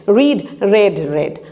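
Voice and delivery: রিড with long ee, then রেড with short e, twice; read vs red. Shown as a demonstration. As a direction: contrast রিড and রেড clearly. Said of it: "উচ্চারণ আলাদা হয়ে যাচ্ছে". (রিড আর রেড আলাদা উচ্চারণ)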